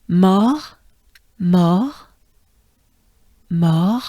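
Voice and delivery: mort with a French R, rising. Shown as a demonstration. Teacher drills mort for students. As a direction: break down mort slowly. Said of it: "let's just go for it".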